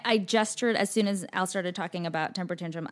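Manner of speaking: usual speaking voice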